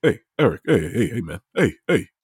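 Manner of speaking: low, terse calls of a dad; friend's dad impression. Showing what Sal's dad sounds like, lightly voiced dad impression. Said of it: "This is what his dad sounds like".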